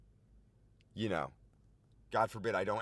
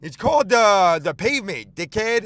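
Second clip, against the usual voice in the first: louder, higher mock yell at a cyclist; angry yelling driver; 7 semitones higher, 11 dB louder than usual. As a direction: bark it like a road-raging driver. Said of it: "He goes".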